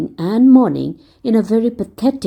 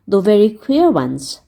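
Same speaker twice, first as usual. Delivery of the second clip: plain reading voice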